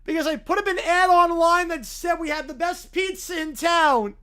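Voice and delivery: loud, defensive character voice; skit schemer. Acted. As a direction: blurt out a loud excuse.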